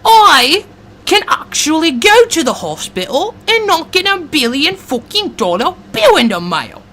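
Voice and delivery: high-pitched, hammed-up Aussie accent; attempted Australian accent. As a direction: put on an exaggerated Australian accent, pitched well up. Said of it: "I'm working on my Australian accent".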